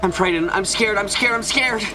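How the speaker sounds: high, shaking terror building; terrified. Acted. Alone in the witch's room, terrified and pleading. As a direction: blurt the fear out over and over.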